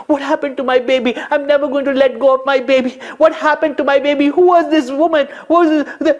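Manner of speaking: very high, loud and fast, near tears; a mother clutching her rescued child; frantic rising cries; distraught mother. Acted. Yashoda frightened for baby.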